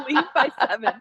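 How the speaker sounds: laughing through the words leave by seven; giggling as she repeats her own text; laughing quote. Retold or imitated. Telling a friend about her text, laughing. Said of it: "I literally said".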